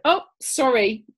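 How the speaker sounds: high, loud, drawn-out apology; mock apology. Owner to dog who snubbed Earl Grey, mock apologetic. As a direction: stretch it out in mock deference.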